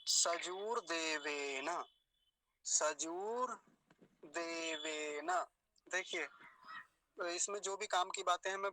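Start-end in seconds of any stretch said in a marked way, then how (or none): 0.1-5.5 सजूर देवेन very slowly, long oo and long e; slow model recitation of सजूर देवेन, vowels held; careful long vowels. Shown as a demonstration. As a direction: stretch each syllable of सजूर देवेन for learners. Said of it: "बड़े ऊ की मात्रा को ध्यान से बोलेंगे".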